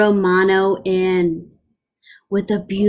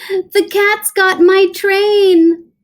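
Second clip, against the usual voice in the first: higher, louder, slow wail; panicked engineer; 10 semitones higher, 6 dB louder, slower than usual. Acted. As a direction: complain in comic panic.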